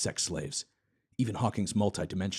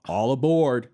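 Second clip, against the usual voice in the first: louder call; call to board; 5 semitones higher, 8 dB louder, slower than usual. Acted. Epstein calling the men aboard, louder. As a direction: hail everyone onto the plane. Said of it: "Epstein calls them to the plane".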